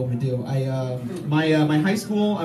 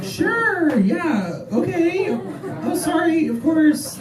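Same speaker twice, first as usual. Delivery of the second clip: high, hesitant, apologetic yes-man; unsure Midwestern voice; 11 semitones higher, much slower than usual. Shown as a demonstration. Comic showing the Midwest accent, high and unsure. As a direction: say yes while doubting yourself, pitched way up. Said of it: "just say anything with less confidence".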